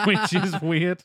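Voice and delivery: slow, laughing through it. Real, not acted. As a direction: laugh while repeating it.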